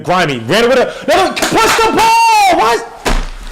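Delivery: very high, loud, slow yell at the screen; real angry fan shout; frustrated yell. Real, not acted. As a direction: scream at the players to press. Yelling at the defense.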